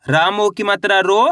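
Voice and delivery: ordinary talk, nothing marked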